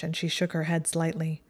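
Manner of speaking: ordinary delivery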